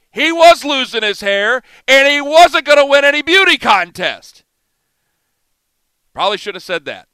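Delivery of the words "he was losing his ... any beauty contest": louder and higher, doubling down; defiant. Real, not acted. Host defending his insult to listeners, defiant and loud. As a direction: punch it out, unrepentant.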